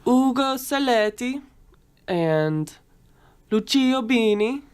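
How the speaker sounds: names said with an Italian accent. Shown as a demonstration. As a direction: roll Ugo Serletti and Lucio Bini the Italian way. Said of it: "You sound so Italian".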